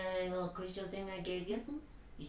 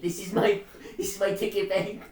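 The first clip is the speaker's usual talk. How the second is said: loud insistent; 4 semitones higher, 15 dB louder than usual. Acted. Character insists loudly on stakes.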